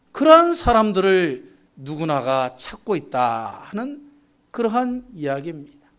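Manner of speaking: normal talking voice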